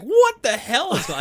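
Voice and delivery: high-pitched mock bewilderment, breaking into laughter; shocked outburst. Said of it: "He's like".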